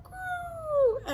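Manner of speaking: dove coo. Acted. Reading the doves' coo to children, high gentle. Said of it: "The doves cooed".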